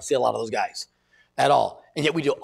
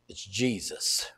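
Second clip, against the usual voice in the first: low, soft and slow; a soft, slow confession of faith; hushed reverent answer; 6 semitones lower, 9 dB quieter, much slower than usual. Pretend. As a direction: let the name land quietly, slowly. Imagined answer to opportunity.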